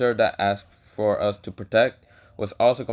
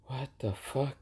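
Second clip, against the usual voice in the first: hushed, acted bewilderment; stunned disbelief; 3 semitones higher, 10 dB quieter than usual. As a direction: say it much softer, as if dumbfounded. Acts out Naruto's stunned reaction.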